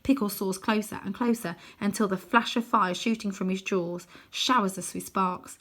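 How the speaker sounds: plain reading voice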